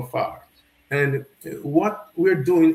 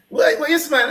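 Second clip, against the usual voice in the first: higher, lightly voiced stranger; a hint of a puzzled stranger asking; a passerby, lightly voiced, quizzing; guy asking; 10 semitones higher, 4 dB louder than usual. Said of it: "the guy said".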